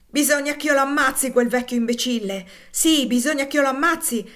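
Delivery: put-on a boy swearing revenge at the top of his voice; furious. A narrator's reading. Boy raging against the trader. (minaccia furiosa, forte e acuta)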